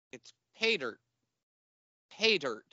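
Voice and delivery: "pay dirt" with PAY stressed, "dirt" falling away; director's correct stress. Retold or imitated. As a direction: model the line, weight on "pay". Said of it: "You don't put the emphasis on dirt".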